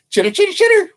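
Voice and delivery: very high, rapid chittering; squeaky high squirrel talk; squirrel chatter. Acted. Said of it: "which squirrel talk means".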